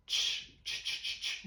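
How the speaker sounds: "Tch, tch" hissed as an unvoiced t-ch burst with no voice; voiceless final t sound. Shown as a demonstration. Teacher showing students the voiceless final sound, unvoiced. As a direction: breathe out "tch" with no vibration in the throat. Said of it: "o som do match no passado vai ser com T".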